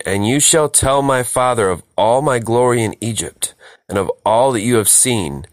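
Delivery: usual speaking voice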